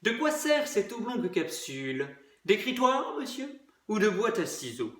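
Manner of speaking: soft, rising inquisitive questions; curious. Acted. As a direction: ask it softly, intrigued. (curieux et doux)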